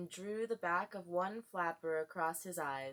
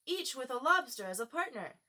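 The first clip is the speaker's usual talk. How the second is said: a bit louder, eager; lightly raised cry; Gryphon crying out; 5 semitones higher, 5 dB louder than usual. A narrator's reading. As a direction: call it out to the dancers. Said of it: "cried the Gryphon".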